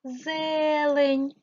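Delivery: Зелень with a long, high first е; Зелень, first syllable held high; stressed check word. Shown as a demonstration. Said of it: "Змінюємо слово".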